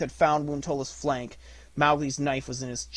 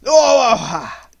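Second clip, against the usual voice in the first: sharp battle cry; 10 semitones higher, 16 dB louder than usual. A narrator's reading. Mowgli strikes in battle.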